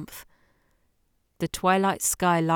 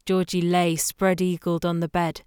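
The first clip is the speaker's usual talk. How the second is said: ordinary delivery, nothing marked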